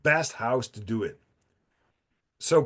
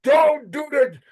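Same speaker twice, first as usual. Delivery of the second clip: higher and louder, a half-laughing warning; emphatic warning; 12 semitones higher, 6 dB louder than usual. Real, not acted. Warning his friend off chewing gum, loud and high. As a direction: call it out like a friendly alarm.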